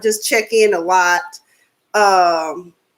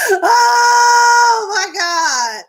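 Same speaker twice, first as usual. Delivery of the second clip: high, loud, slow laughing cry; laughing exclaim; 10 semitones higher, 5 dB louder, much slower than usual. Real, not acted. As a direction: gasp it out through laughter. Reacting to own impression.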